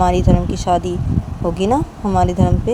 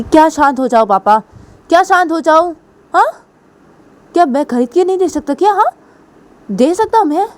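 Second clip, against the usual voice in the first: angry outburst; 8 semitones higher than usual. Pretend. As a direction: boast in a rage. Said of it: "PU गुस्सा हो जाती है".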